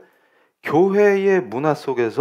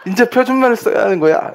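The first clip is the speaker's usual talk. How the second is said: high, loud mock scolding; hammed-up telling-off, pitched way up; angry mother; 9 semitones higher, 3 dB louder than usual. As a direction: imitate a mom drilling her child crossly. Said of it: "엄마가 화를 내면서".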